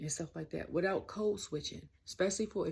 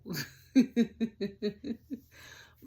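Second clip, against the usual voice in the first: loud high laugh; 6 semitones higher, 8 dB louder than usual. Real, not acted. Laughing at her own joke about the wrestler, loud laugh. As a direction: burst of giggling laughter.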